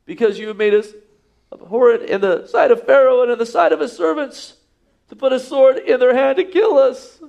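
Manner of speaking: high, strained accusing cry with a laugh in it; indignant accusation. Acted. Officers voiced accusing Moses.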